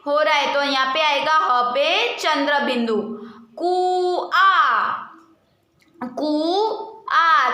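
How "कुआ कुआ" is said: कुआ stretched very slowly, nasal आ held; drawn-out demo word. Shown as a demonstration. Teacher to pupils, modelling the nasal ending, slow कुआ. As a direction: draw out the end of कुआ. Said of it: "आ के पीछे pronounce आ रहा है".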